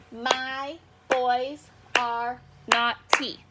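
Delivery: very slow, each syllable set apart. Shown as a demonstration. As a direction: count out every syllable, "naughty" split in two. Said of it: "testing the syllables as you go".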